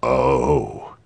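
low, cool tyrant; sinister calm, voice sunk low; menacing governor. A narrator's reading.

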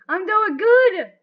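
loud, high and drawn out; excited boast. Real, not acted. Player cheering their own progress, excited boast. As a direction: crow it slowly at the top of the voice. Repeats it louder, celebrating progress.

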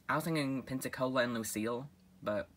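ordinary delivery

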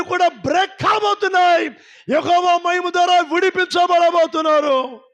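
building to a loud rousing shout; preaching at full force. Acted. Pastor rousing the congregation about breaking free, loud preaching. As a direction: preach it hard, climbing toward the breakthrough.

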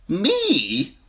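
high startled "Me?"; a light fake laugh of a question; nervous merchant laughing off the cup; wine seller, laughing it off. A narrator's reading. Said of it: "the man laughed".

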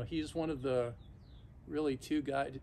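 usual speaking voice

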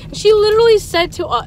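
ordinary talk, nothing marked

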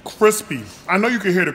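talking between bites; chewing while talking. Real, not acted. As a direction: mouth partly full. Seen 0: Ate fry at -9; jaw, lips chewing until camera turns to plate.

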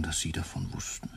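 breathless whisper; ashamed whisper; whispered admission. Acted. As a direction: drop to a whisper, caught out. Caught employee, confession.

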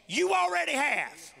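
God yelling back before they can answer. Acted. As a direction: yell the answer right in their face. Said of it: "he yells".

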